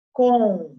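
loud, clear classroom callout of "Controla"; read out loudly. Shown as a demonstration. Teacher calling a word to pupils, loud. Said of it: "Em vermelho".

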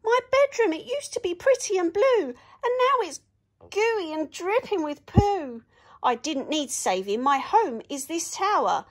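high, loud furious princess rant; furious princess scolding the heroes; angry princess. Acted. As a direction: tell off the intruders who wrecked her room. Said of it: "one angry princess".

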